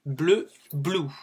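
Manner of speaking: "Bleu" French, then "blue" with a long oo; French then English. Shown as a demonstration. A teacher contrasts French bleu with English blue. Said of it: "Le u c'est un ou".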